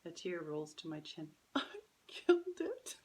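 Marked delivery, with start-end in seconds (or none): 1.5-3.0 put-on higher, slow, tearful little girl; tearful child. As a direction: choke it out as a guilty child.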